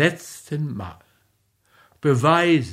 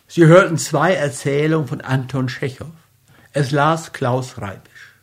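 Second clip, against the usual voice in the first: brisk, louder program announcement; radio announcer; 4 dB louder, faster than usual. Acted. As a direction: read the outro like a radio host.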